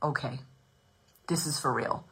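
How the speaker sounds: low, hushed husband impression; understated, deep-pitched mock husband; imitating husband. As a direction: drop low and quiet, as the husband taking it in. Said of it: "my husband's like".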